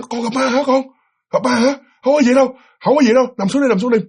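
acted dad coaxing a child back down; reassuring father. Father telling scared child it's nothing, reassuring.

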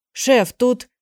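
a voice calling from the kitchen; from another room. A narrator's reading. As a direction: shout from the next room. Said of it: "раздался голос из кухни".

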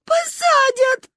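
high, loud slow wail; sobbing. A narrator's reading. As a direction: wail in agreement. Agreeing while crying. (рыдая)

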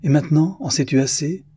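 furious. A narrator's reading. Artist cutting off his brother's questioning, furious. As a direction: throw the question back angrily. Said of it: "cria presque Damian furieux".

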